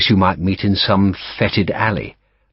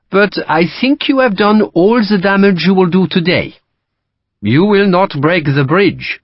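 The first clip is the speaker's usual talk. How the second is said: French officer; 11 semitones higher, 5 dB louder than usual. Acted. Lacroix to Sharp, dismissive French accent.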